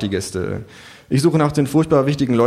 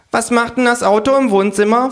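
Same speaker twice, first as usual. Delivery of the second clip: kangaroo voice; 13 semitones higher, 5 dB louder than usual. Acted. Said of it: "fragt das Känguru".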